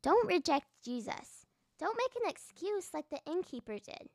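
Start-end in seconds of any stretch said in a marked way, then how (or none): none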